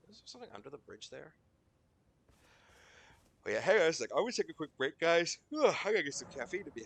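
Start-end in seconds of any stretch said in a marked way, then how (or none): 3.4-6.8 louder, words pushed out through a yawn. Real, not acted. Streamer tells viewers he's taking a break, yawning loudly. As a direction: stretch and yawn while announcing the break. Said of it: "I gotta stop fucking yawning".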